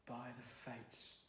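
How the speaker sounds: slow, soft mutter under the breath. A narrator's reading. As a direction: mutter it in awe, barely audible. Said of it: "someone muttered".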